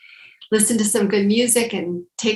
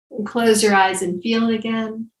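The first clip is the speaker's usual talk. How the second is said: guided breathing instructions. Acted. Leads shoulder breathing exercise.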